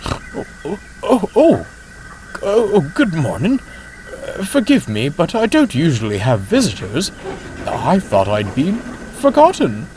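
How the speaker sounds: high, startled, fluttery voice; flustered old coach. Acted.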